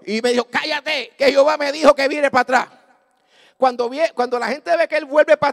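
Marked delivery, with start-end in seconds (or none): 0.5-2.7 loud, insistent silencing; acted sharp rebuke. Preacher silencing his frightened companion, loud rebuke. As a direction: overrule him with full force.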